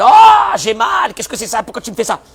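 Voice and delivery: put-on high, shouted child's protest; acted cry of pain. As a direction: yelp out loud, then protest in a high voice. (cri de douleur joué, aigu et crié)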